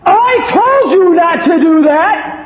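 high, loud, slow furious scolding; a harsh God shouting at the bleeding child; cruel scolding God. Acted. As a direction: berate the hurt child without mercy. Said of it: "what many people think of God".

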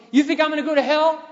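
high, loud, goading question; imitating a coworker's loud dare; mock taunting challenge. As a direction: call him out loudly before the crowd. Coworker taunts him before the crowd.

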